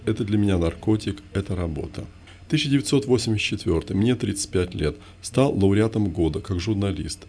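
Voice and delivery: usual speaking voice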